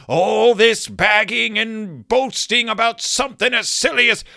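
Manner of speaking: mock furious grandpa building to a shout; angry Gramps. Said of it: "he looks angry".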